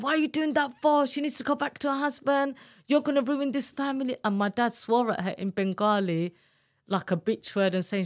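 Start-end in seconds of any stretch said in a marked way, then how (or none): 0.0-4.2 higher, indignant telling-off; retold scolding. Daughter reproaches her swearing mother, indignant. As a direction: scold your mother for wrecking the family. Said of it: "I was like".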